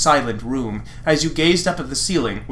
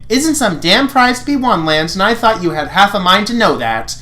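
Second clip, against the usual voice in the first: scolding Pidge; 5 semitones higher, 3 dB louder than usual. Acted.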